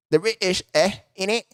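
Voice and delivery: a light mocking British "innit" accent. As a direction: tease them in a half-hearted British accent. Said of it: "weirdest fucking accent".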